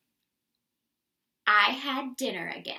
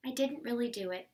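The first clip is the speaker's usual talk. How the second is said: usual speaking voice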